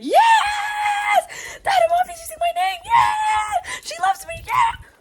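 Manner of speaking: very high, loud squealing cheer; ecstatic cheering. Retold or imitated. Reader retelling her first delight at Miss Amina, ecstatic cheering. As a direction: shriek it like a thrilled fangirl. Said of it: "at first I was, like, really like".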